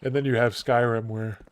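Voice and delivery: talking through a real yawn. Real, not acted. Streamer talking Skyrim to viewers, yawning. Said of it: "sorry, I'm yawning".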